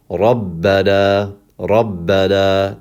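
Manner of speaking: slow Rabbana with a held double b; doubled ba. Shown as a demonstration. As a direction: lean on the bb of Rabbana, long final aa. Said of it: "as if there was two bas".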